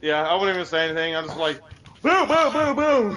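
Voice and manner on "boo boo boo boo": high, loud booing; mock booing. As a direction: boo them like a heckler. Mocking booing at a friend.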